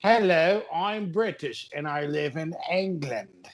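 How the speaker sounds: hammed-up British accent, slow; a mock posh English voice. Asked for a British accent, goofing it up. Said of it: "Do your best British accent".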